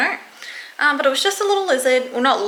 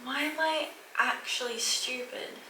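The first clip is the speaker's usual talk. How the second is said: ordinary delivery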